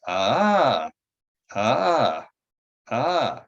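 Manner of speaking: mild imitation of a patient's repeated cries; imitated cry-out noises. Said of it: "she would make these noises like".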